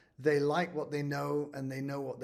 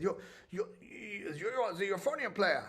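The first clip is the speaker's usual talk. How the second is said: put-on higher-pitched German-accented old lady; imitated German accent, pitched up; 5 semitones higher than usual. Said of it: "I shouldn't do German accents".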